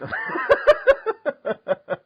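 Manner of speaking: long high-pitched laugh; loose, rolling high giggle. Real, not acted. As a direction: burst out laughing at your own remark. Laughs at own remark.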